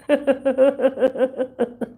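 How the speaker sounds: high-pitched burst of laughter. Real, not acted. Laughing at James's joke, high-pitched laughter. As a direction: crack up high.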